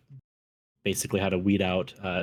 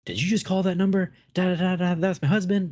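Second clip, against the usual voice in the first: higher, louder mock wife on the phone; high, louder caller; mock angry wife; 10 semitones higher, 4 dB louder than usual. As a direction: play the upset wife, pitched up. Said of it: "She calls and she goes".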